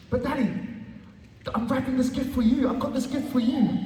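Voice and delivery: put-on high, earnest child's voice; little girl pleading. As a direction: plead like an eleven-year-old.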